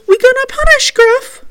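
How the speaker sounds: put-on very high, loud boy voice; imitating Jacob. Telling viewers the spirit boy's last line, high imitation. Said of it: "Then Jacob says".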